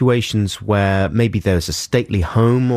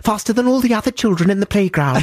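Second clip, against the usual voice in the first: put-on much higher, polite pleading child; sweet little boy. Dad voicing the dream wish of his six-year-old son, high pleading child voice. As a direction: coo like a perfectly well-behaved son.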